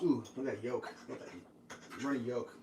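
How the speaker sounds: ordinary delivery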